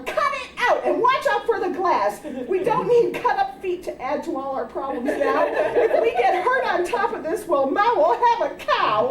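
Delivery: high, laughing mock holler; big sister's mock holler. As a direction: order the sisters off the glass. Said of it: "Jane would holler".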